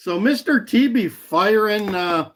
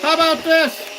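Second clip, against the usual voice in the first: high, far-off put-on voice, much higher than his own; Elon Musk impersonation; 10 semitones higher than usual. Streamer playing Elon Musk with a chainsaw, high put-on voice. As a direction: play a gleeful tech boss cutting jobs. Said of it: "that's my Elon Musk Doge Saw impersonation".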